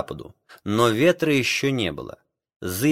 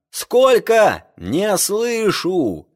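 shouting over wind; 9 semitones higher, much slower than usual. Acted. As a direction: strain to be heard. Captain shouts over storm.

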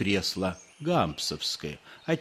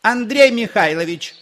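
high, loud shout of a woman; irritated wife yelling; 10 semitones higher, 8 dB louder than usual. A narrator's reading. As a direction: call back sharply from indoors. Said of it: "закричал женский голос".

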